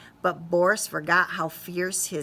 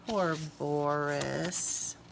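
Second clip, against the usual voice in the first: soft, very slow pitying sigh; tender pity; sympathetic; 5 semitones lower, 7 dB quieter, much slower than usual. A narrator's reading. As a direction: feel sorry for him. Narrator sympathizes.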